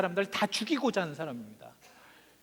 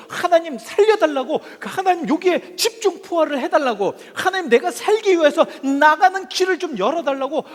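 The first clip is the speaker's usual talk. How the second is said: high, loud plea to God; shouted crying out; 7 semitones higher, 9 dB louder than usual. Acted. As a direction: act out a desperate prayer for rescue. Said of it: "막 소리치면서 울부짖는 것이". (소리치며 울부짖는 기도)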